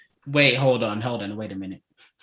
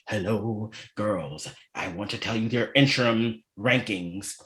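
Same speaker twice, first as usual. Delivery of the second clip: imitating host; 5 semitones lower, 6 dB quieter than usual. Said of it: "the host is like".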